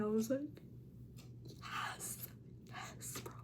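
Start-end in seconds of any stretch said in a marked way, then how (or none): 1.5-3.3 unvoiced-style whispered reenactment of awe; soft, airy retold agreement; hushed excitement. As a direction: whisper it like an overwhelmed fan. Retells reaction to actor's praise.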